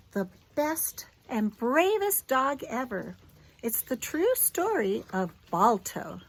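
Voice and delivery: gentle storybook read-aloud; clear, expressive storyteller voice for a little one; reading to a child. Acted. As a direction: read it like a bedtime picture book. Reads picture book to child.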